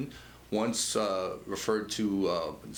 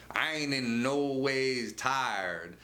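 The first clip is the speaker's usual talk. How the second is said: mock Southern accent; 3 semitones higher, much slower than usual.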